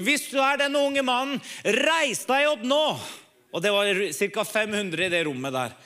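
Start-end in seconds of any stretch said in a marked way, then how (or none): 0.0-2.9 rally the room like the American preacher; preacher's call. Retold or imitated. American preacher calls the young man to stand, ringing call. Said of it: "så han sier".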